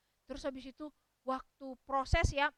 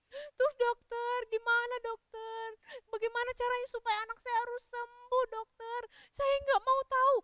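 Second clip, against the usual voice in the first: grief-stricken pleading; 7 semitones higher, 5 dB louder than usual. Acted. Mother demands a cure from the doctor, wailing in grief. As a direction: beg through hammed-up sobs, pitched high. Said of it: "Pasti mamanya sedih sekali".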